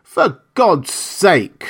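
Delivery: high, drawn-out exasperated plea; crying out. A narrator's reading. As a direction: implore him, stretching each word. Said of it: "cried Montgomery".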